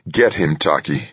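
plain reading voice

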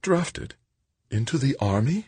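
high-pitched mother's startled questions; worried mother. Acted. Mother reacts to son being drafted, worried.

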